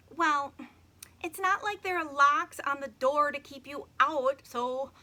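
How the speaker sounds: put-on loud, high, grudging girl; a little girl, grudging; girl's voice. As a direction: push it out, a bit put out. Emily answers Logan.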